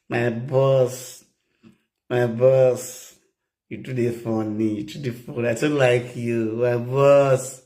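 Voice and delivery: slow, coaxing "my boss" in a woman's voice; flirty woman. Retold or imitated. Woman charming her suitor, slow coaxing voice. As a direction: coo it to him like a charmed woman.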